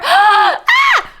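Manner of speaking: sharp gasp then very high loud ah; excited gasp. Real, not acted. Spotting the Tibetan calendar link, gasps and squeals high.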